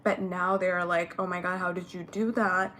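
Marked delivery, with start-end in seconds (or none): none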